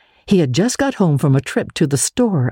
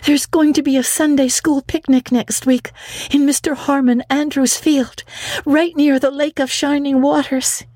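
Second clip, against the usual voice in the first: put-on much higher, eager girl pleading; breathless excitement; 13 semitones higher than usual. Anne begging Marilla to go to the picnic, much higher. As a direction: gush the news then beg. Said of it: "she exclaimed breathlessly".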